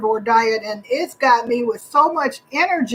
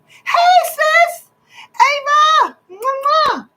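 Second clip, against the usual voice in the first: high, squealed hello with kisses; excited greeting; 14 semitones higher, much slower than usual. Real, not acted. Streamer greeting her sis as she joins live, excited. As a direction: greet loved ones in a high, delighted singsong.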